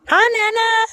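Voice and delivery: high sing-song hello; high excited greeting. Real, not acted. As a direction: greet Nana brightly, high. Greeting grandmother cheerfully.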